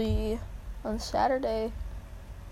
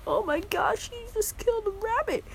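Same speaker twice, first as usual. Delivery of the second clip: much higher panicky squeal; high mock panic; freaked-out Em; 11 semitones higher than usual. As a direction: shriek it in fake panic. Imagining Em freaking out.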